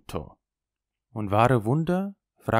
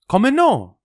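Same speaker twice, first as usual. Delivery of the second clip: loud, high cry of protest; exclaiming; 12 semitones higher, 10 dB louder than usual. Acted. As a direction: call it out, almost offended. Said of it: "rief er".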